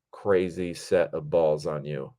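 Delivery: normal talking voice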